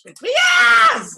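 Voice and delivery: high, loud gleeful yell; excited shout. Retold or imitated. Acting out own reaction to Luann, excited shout. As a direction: shout the yes way up high and long. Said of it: "And I'm like".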